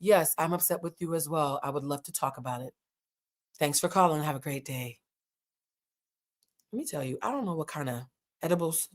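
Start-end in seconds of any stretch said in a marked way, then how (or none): 0.0-5.0 calm Stacey. Retold or imitated. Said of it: "And Stacey says".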